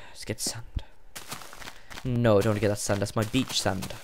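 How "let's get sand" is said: whisper. Real, not acted. Murmurs to himself.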